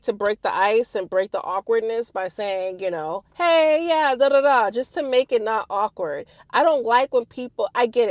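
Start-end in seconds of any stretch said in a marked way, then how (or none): 3.3-4.7 higher, louder friendly hello; breezy small-talk opener; cheery icebreaker. Retold or imitated.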